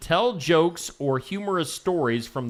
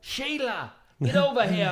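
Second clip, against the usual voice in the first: higher, hammed-up Aussie accent; mock Australian twang, pitched up; 8 semitones higher than usual. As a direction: call out in a put-on Australian accent. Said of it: "I was doing an Australian accent".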